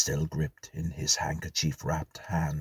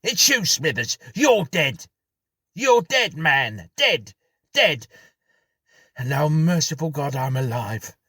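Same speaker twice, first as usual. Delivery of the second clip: very high, loud gleeful yelling; shouted back; 10 semitones higher, 10 dB louder than usual. Acted. Jackson shouting back at the ghost, gleeful triumph. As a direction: shout with wild, joyful triumph. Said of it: "as he shouted back".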